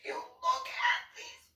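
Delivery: a shrill parody tattling to a brother; mocking Anna. Anna urging police officer Zach to read the books, high mock squeal. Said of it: "and be like".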